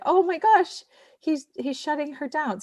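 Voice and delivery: high-pitched, aghast exclamation; dismayed reaction. Retold or imitated. Listener reacting to the devaluing scene, dismayed. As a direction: jump up high in alarm, then ease off in volume. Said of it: "the devaluing thing is like".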